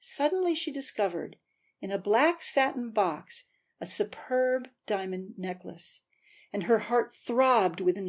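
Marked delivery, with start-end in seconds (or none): none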